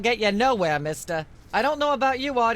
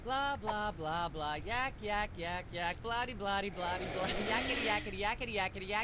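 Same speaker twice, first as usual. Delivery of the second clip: background chatter; 2 semitones lower, 10 dB quieter than usual. Acted. Myra drones on beneath talk.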